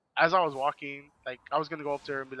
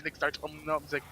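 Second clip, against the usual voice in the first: normal talking voice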